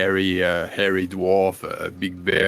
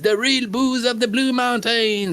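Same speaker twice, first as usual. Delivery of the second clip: salesman barkeep; 14 semitones higher than usual. Acted. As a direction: hawk it like a market crier. Barkeep pitching booze to dwarves.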